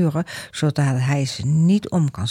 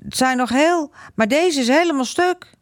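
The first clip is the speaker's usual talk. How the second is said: put-on angry boy; 11 semitones higher than usual. Angry boy showing the wall.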